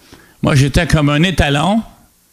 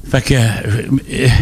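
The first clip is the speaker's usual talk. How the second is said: ordinary talk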